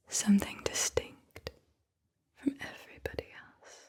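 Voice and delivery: slow whispered verse with long pauses between phrases; whispered poem reading. Acted. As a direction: whisper the poem phrase by phrase, letting each one settle. Reads a poem aloud.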